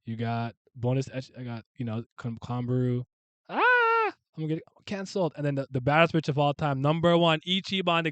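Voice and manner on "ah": surprised yelp. Real, not acted. Yelp before a joke.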